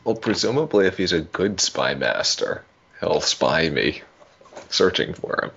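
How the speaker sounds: usual speaking voice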